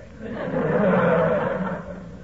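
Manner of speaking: long booming laugh at his own joke; rolling chuckle after the punchline; hearty laugh. Acted.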